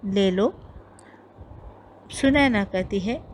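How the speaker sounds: ordinary talk, nothing marked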